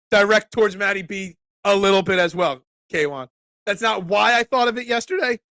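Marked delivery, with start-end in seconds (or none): 3.7-5.4 voice crack. Real, not acted. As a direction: voice cracking mid-sentence. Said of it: "That's the third voice crack right there today".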